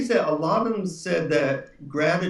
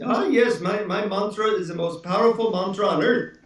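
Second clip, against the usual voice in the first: put-on guru in a higher-pitched Indian accent; 5 semitones higher than usual. Guru proudly answering the traveler about his mantra, Indian accent. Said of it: "And in his Indian accent".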